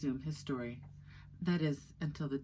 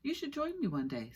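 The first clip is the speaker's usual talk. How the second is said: sudden; 12 semitones higher than usual. Acted. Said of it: "said Flea suddenly".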